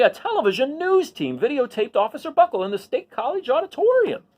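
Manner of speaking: lively storybook read-aloud; reading to children. A narrator's reading. Teacher reads a picture book to the class, lively storybook voice. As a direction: tell the story to a class of kids.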